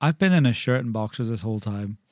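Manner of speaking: usual speaking voice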